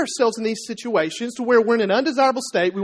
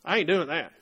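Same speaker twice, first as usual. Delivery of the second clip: low, soft, grumpy refusal; Uncle Bill; 5 semitones lower, 5 dB quieter than usual. Acted. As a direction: refuse quietly from deep in the corner armchair. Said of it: "he's leaning back, and he's going".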